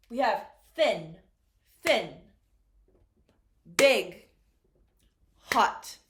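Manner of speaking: thin, big and hot each said loud and separately; teacher drilling adjectives loudly; emphatic model words. Shown as a demonstration. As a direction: punch each adjective like a flashcard. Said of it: "one syllable adjectives".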